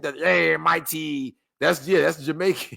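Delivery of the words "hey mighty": drawn-out Irish sailor hail; Irish sailor accent. Acted. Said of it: "that Irish sailor accent".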